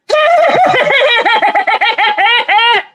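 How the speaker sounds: very high, long Wicked Witch cackle; stupid laugh. Shown as a demonstration. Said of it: "That's how I laugh".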